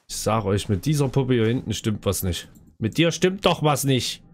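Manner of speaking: ordinary talk, nothing marked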